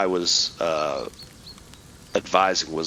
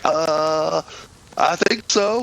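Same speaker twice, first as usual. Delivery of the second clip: unsure lieutenant; 7 semitones higher, much slower than usual. Retold or imitated. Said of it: "He's like".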